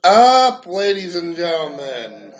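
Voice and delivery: loud, slow drawn-out hello; big shout-out greeting. Real, not acted. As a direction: call out to the crowd, stretching it. Greeting the audience, calling out.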